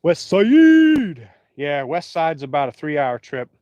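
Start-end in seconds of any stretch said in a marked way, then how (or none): 0.0-1.3 high, loud shout of the vote; hyped call-out. Real, not acted. Streamer shouts a chat vote to viewers, high loud call-out. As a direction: belt it out like a chant.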